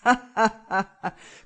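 loud mocking villain laugh; evil laugh. Killer laughing at the panicking husband, loud evil laugh. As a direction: laugh at him with cold glee. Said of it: "während Luc nur lachte".

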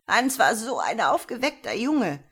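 put-on loud, high, swooping old woman; almost shouting. A narrator's reading. Old woman telling the officer of her great-grandson, almost shouting. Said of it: "Fast schreiend führte die alte".

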